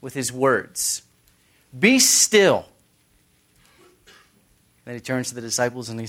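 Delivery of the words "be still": loud, high acted command; forceful retold order, pitched up; commanding rebuke. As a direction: rebuke the sea with authority. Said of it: "He rebukes the sea".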